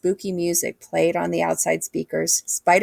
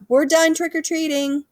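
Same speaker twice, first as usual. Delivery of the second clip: calling out; 10 semitones higher than usual. Acted. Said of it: "I called out".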